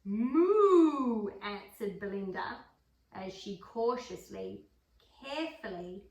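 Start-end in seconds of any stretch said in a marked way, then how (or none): 0.0-1.3 cow moo. A narrator's reading. Cow answers farmer.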